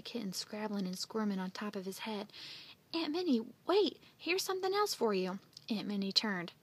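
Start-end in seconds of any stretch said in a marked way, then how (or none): 2.9-5.4 high, urgent call to stop; calling after someone. A narrator's reading. Andy stopping his aunt leaving, urgent call. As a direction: call her back eagerly.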